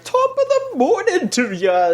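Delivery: high-pitched attempt at an Irish accent; bad Irish accent. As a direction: put on a dubious Irish lilt. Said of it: "Was that your actual Irish accent?".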